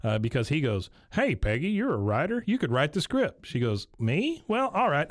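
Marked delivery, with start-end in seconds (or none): 1.1-3.4 higher-pitched, lively quoted pitch; eager husband pitching. Retold or imitated. Acting out cartoon husband pitching wife. 4.0-5.1 high, coy "who, me?"; feigned modesty. Retold or imitated. Acting out wife feigning modesty.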